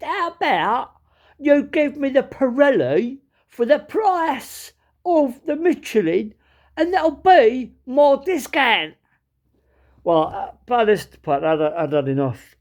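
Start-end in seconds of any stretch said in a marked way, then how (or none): 0.0-8.9 high, drawn-out Cockney haggling. Retold or imitated. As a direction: spell out the offer like an East End chancer. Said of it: "this guy was proper Cockney".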